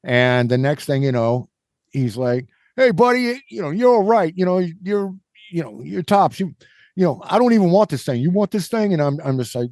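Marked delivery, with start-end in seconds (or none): 2.8-8.9 higher-pitched imitation of a chummy New Yorker; mock New York accent. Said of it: "I am butchering the New York accent".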